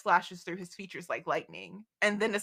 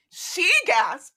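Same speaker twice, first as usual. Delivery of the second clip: high, loud mock gasp; imitating a gasp; 9 semitones higher, 8 dB louder than usual. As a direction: gasp in shock like Bree. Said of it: "Bree is gasping".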